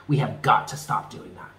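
ordinary talk

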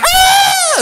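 very high, loud mock scream; kid's terrified scream. Kids fleeing the prank, high mock scream. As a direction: shriek like a frightened child. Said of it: "And they're like".

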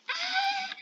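very high-pitched little scream; high squeal. Pretend. Player squashed among others, high squeal. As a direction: let out a thin high squeal. Squeal when crowded in game.

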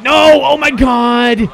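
anguished yell at the bad play; frustrated shout. Real, not acted. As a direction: shout in despair as the run scores. Opponent scores a hit.

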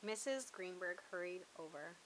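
ordinary delivery

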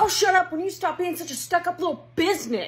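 yelling back at sister; heated snippy retort; angry. Acted. Sibling quarrel.

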